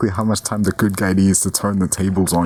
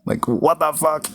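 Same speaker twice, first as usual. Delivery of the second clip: high, shocked disbelief; freaking out; 10 semitones higher than usual. Retold or imitated. Howard hears all the money is gone, high panic. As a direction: squeal it in panic. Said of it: "he's freaking out".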